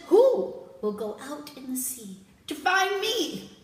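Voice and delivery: rally your companions to end the crying; commanding prince. Acted. Prince asks his trusted companions, loud and demanding.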